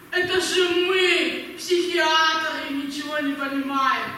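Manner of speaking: slow, drawn-out mock self-deprecation; loaded, sing-song sarcasm; bitter sarcasm. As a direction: throw the insult back, stretching each word. Psychiatrist mocks his earlier insult.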